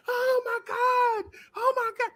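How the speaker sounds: very high falsetto mock squeal; imitated screaming girl. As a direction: mimic a starstruck girl squealing. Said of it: "the girl starts screaming".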